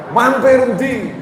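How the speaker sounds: a touch of jealous interrogation, slowed; mock suspicious wife. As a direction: drag it out like a suspicious wife, lightly acted.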